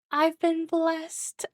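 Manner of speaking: very high, very slow, lilting; stretched high singsong, gleeful; singsong blessed. Real, not acted. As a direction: sing it out like a gift from heaven.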